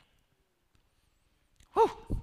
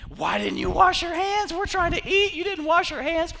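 much higher, loud indignant scolding; outraged Pharisees; 9 dB louder than usual. Acted. Pharisees scolding the disciples for unwashed hands, high indignant outrage. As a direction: shriek it like fussy offended rule-keepers.

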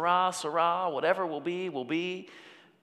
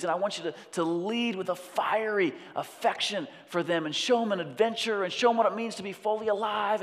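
higher, louder pleading father's call to his son; 5 semitones higher, 4 dB louder than usual. Acted. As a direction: call the son home with fervent love. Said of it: "He's saying".

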